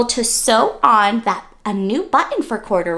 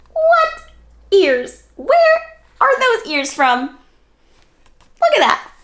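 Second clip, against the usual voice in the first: high louder mock surprise; 12 semitones higher, 4 dB louder than usual. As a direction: exclaim as if spotting the ears. Storyteller mock surprise at ears.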